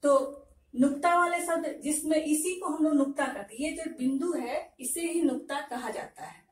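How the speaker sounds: usual speaking voice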